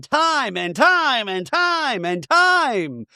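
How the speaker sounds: high, slow, drawn-out mock yell; mock screaming. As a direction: stretch each time like a shrill rant. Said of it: "[name]'s screaming".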